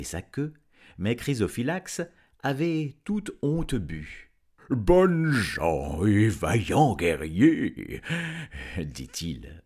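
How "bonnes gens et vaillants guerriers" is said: loud, very slow dragon voice; panting dragon. A narrator's reading. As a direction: draw it out heavily, as a winded dragon. (dragon haletant, voix forte et lente)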